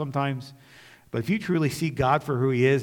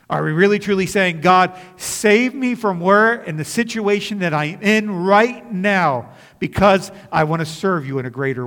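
higher and louder, an urgent challenge to the congregation; fervent preaching; 6 semitones higher, 8 dB louder than usual. Acted. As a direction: call them out from the pulpit.

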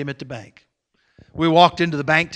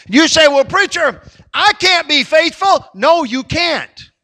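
high, loud pulpit delivery; full-force preaching; 11 semitones higher, 9 dB louder than usual. Acted. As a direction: cry it out to the congregation.

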